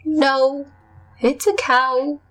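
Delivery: title announcement. Shown as a demonstration. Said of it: "is the name of this week's episode".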